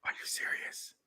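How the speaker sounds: hushed, unvoiced question; whispered disbelief. Host answering a secret confession, whispered disbelief. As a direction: whisper back in mock shock.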